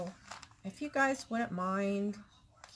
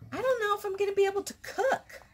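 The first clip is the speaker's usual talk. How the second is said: much higher, fast, half laughing whine; retelling her fretful reply to her husband, higher and quicker with a laugh; mock whiny self; 12 semitones higher than usual. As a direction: ham up your own panicked complaint. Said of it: "I was like".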